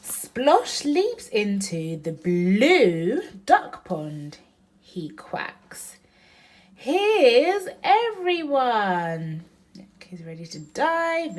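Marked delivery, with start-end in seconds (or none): 0.0-6.0 louder, slow, sing-song picture book reading; reading to a child. Acted. Mom reading Splosh to Oriana, loud and slow. 6.8-9.4 slow, louder, higher triumphant duck voice; Splosh delighted. Acted. Mom voicing Splosh finding the ducks, slow and loud.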